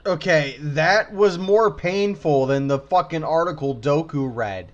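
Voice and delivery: ordinary delivery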